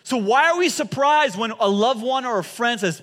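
ordinary talk, nothing marked

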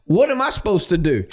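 mock helpless exasperation; exasperated coach. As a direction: imitate a coach at wit's end. Retelling coach's exasperated phone reaction.

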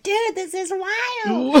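high, slow dazed imitation; mock Swayze line. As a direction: draw it out like a stunned surfer. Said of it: "Patrick Swayze's face is just like".

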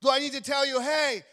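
loud, forceful preaching. Acted. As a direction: preach it hard to the congregation.

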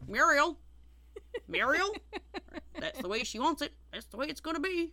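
hammed-up loud old husband; loud movie husband quote. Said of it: "and he's going".

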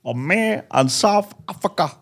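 hammed-up South African speech-making voice; Nelson Mandela. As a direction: intone it like a bad impression of a freedom leader. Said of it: "No, Nelson Mandela".

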